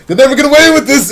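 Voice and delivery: high, loud, rushed declaration; fast hammed-up heroic bluster; mock-heroic cry. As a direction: shout it as a fleeing hero. Jim's mock-heroic cry while fleeing.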